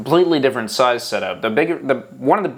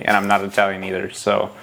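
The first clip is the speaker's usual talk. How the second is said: usual speaking voice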